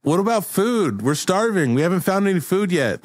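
high-pitched hungry whine; complaining crew member. Acted. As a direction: plead high on behalf of the crew.

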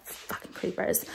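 soft whispered curse. Real, not acted. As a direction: hiss it under your breath. Muttering about creep outside.

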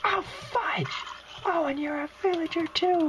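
very high, exasperated squeal; alarmed exclamation. Real, not acted. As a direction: shoot the pitch up in alarm. Alarmed at the monster he meets.

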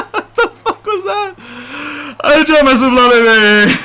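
high, squealing words through laughter; real helpless laughing fit; hysterical laughter. Real, not acted. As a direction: crack up and shriek the words while laughing. Cracks up at game mishap.

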